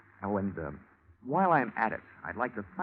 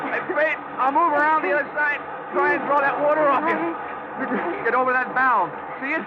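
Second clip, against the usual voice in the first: loud, much higher calling across the roof; shouting over water; 12 semitones higher, 5 dB louder than usual. Acted. Detective shouts to partner over the fire hose roar.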